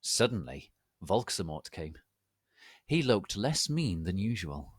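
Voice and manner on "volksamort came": ordinary delivery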